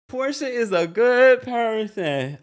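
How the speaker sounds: slow, laughing, words slurring; slurred through laughter. Real, not acted. Vlogger trying to defend Portia, laughing and slurring. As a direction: force it out through the giggles. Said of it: "I'm slurring".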